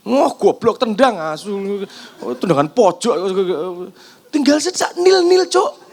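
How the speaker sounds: yelling football pundit, swearing at the kick; frantic mock sports commentary. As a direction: rant at the match like a fan glued to the TV. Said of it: "seperti komentator sepak bola".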